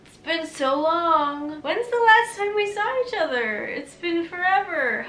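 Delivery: high, loud forced cheerful greeting; fake enthusiasm. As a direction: overdo the delight to hide forgetting. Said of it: "I'm like".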